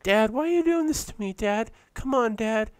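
hammed-up whine at her father; mock whiny daughter. Mocking a dying daughter begging her dad, high and whiny. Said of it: "she's like".

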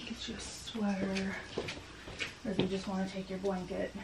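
very soft whisper; hush, speak barely aloud. Real, not acted. Whispering to sick child.

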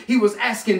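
ordinary talk

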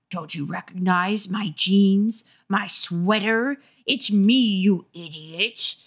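slow, gruff monster voice; a hint of a rough masked monster. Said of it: "declared in the gruff voice".

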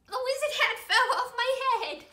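loud, high, quick laughing aside; real giggly interruption; amused outburst. Real, not acted. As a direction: laugh through the mishap. Hat falls off mid-song.

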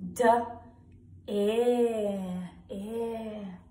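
D, then ear, sounded out slowly; sounding out. Shown as a demonstration. Teacher sounding out a word for young pupils, slow and clear. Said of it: "Let's sound it out".

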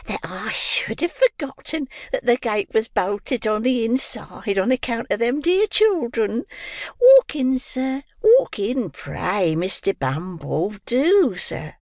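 high, slow gushing landlady voice; Mrs Mann voice. Acted.

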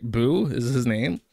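ordinary talk

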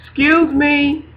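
mock-indignant, stretched slowly; frustrated calling out. Speaker pestering God about being ignored, mock indignant. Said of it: "He knows I'm frustrated".

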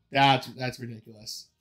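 normal talking voice

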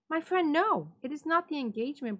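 high, quick false worry; feigned concern. A narrator's reading. Montresor faking concern for his friend, high and quick. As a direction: pretend to hold him back.

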